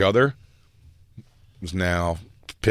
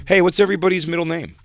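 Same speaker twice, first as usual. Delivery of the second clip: higher-pitched, put-on curiosity; mock innocent question; 8 semitones higher, faster than usual. Acts out question to the group.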